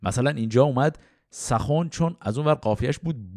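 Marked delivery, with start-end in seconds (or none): 1.3-1.9 سخن said as "sakhon" to rhyme; old Persian "sa-KHON" for سخن; archaic rhyming pronunciation. Shown as a demonstration. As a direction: say سخن with a first, then o. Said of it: "تلفظ قدیمش رو به کار میبرم".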